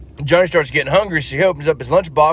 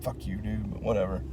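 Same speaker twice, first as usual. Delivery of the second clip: under the breath, much softer; muttered curse; 5 semitones lower, 14 dB quieter than usual. Acted. Boy cursing grandpa under his breath, muttered. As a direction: grumble it low and quiet. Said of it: "Johnny's kinda pissed off".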